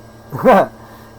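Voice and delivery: sudden loud, high laugh; one short high burst of real laughter. Real, not acted. Trainer amused by his own label, loud laugh.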